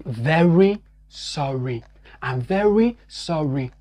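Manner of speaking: slow, careful "Very sorry" with an English R. Shown as a demonstration. Teacher modelling the phrase for practice, slow. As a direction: model it slowly. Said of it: "travailler à reproduire".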